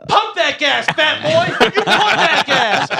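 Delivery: high, laughing taunt; mock taunting the gas worker; retold taunt. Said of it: "and I go".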